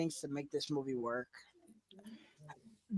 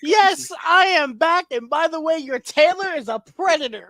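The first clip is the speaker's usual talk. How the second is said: a hint of Deadpool, louder and higher; Deadpool quote; 6 semitones higher, 7 dB louder than usual. Fan quoting Deadpool's suit-up line, hammed-up and loud. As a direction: proclaim it like a hammed-up hero return. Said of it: "He was like".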